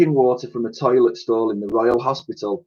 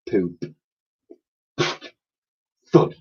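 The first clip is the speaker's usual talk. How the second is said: plain reading voice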